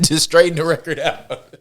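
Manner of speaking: amused, laughter breaking into the words; laughing. Real, not acted. Setting listeners straight about the first anthem, laughing. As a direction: talk through a laugh.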